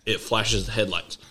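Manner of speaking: usual speaking voice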